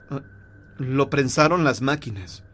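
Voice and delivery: ordinary delivery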